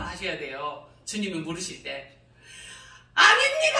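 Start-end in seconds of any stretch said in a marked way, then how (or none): 3.1-3.8 high, loud crying cry of denial; tearful shout. Retold or imitated. Preacher reenacting her tearful shouted answer to God. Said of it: "얼마나 큰 소리를 했겠어요".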